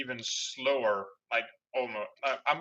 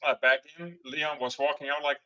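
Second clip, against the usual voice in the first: usual speaking voice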